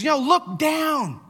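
high, drawn-out shout; retold shout. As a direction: call it back over the noise, stretched out. Friend calling back on the motorbike.

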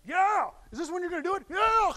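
high, loud excited yell; eager shout. Retold or imitated. Peter hyped up before the arrest, shouting. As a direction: Peter all fired up, ready to fight.

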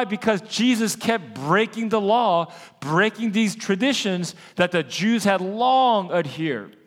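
rising, emphatic sermon voice; preaching. Acted. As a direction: preach it with growing fire.

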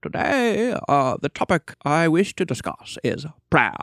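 imitating a mannered lecturer; Lewis impression. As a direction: put on an author's affected recorded voice. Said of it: "he says it like that".

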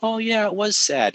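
put-on high, childlike agreeing voice; little boys agreeing. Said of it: "they're like".

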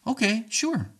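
high, lilting agreement; accommodating. Acted. Speaker answers an accusing partner without arguing, accommodating. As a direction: give in easily, pitched well up.

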